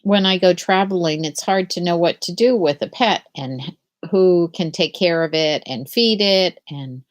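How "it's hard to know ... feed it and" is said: usual speaking voice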